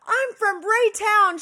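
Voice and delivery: high, loud, slow yell; shouted. Retold or imitated. Neighbor shouting her hometown across the courtyard, loud. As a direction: call it proudly across the yard. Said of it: "screaming across the courtyard at each other".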